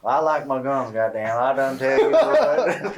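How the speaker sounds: hammed-up Southern drawl, laughing; slow, laughing Southern twang; Southern accent. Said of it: "southern accent".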